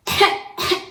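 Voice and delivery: a forced, sharp cough; loud cough. Shown as a demonstration. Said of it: "cough really loudly".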